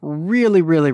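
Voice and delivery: ordinary delivery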